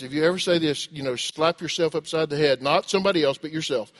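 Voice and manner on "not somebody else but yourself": normal talking voice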